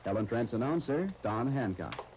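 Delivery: smooth, persuasive commercial pitch; radio ad read. Acted. Announcer selling lipstick to listeners, smooth and persuasive. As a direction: sell the lipstick with polish.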